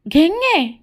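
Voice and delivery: high, swooping dramatic call; melodramatic cry. Acted. Rejected boy bursts out at his friend, melodramatic cry. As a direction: wail the name like a film hero.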